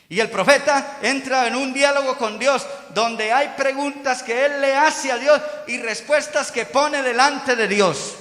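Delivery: high, loud pulpit delivery; emphatic preaching. Real, not acted. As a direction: drive each phrase home to the congregation. Preaches at full force.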